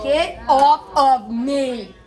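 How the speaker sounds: slow, pushed-away snap; mock porcupine bristle; snappy get off me. To Brandon, who tries to hold her, a slow mock snap. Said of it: "And I'm like".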